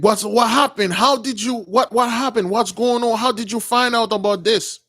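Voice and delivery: high-pitched, agitated acted questions; urgent questioning. Prince asking Moses how he found out, urgent questioning. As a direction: raise the pitch and tumble out the questions. Retold friend firing alarmed questions.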